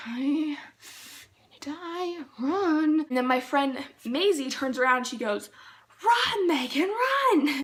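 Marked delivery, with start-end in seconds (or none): 0.1-3.1 higher, drawn-out plea to flee; hammed-up desperate warning; mock panicked warning. Urging lagging friend to flee. 6.0-7.6 high, loud yell to a lagging friend; imitating a friend's panicked shout; urgent shout. Friend's retold cry to flee.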